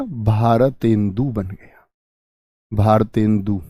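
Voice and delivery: spoken slowly, every syllable spelled out; slow clear demo. Shown as a demonstration. Teacher slowly models Bharatendu for the class, drawn-out syllables. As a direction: draw out Bharatendu for the students. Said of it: "तो क्या बना?".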